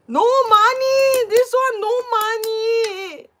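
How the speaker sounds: high, loud, drawn-out imitated call; shouting to deaf father. Owner to old father in the back, imitated drawn-out shout. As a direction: holler it slowly to someone hard of hearing.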